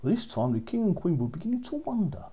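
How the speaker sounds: usual speaking voice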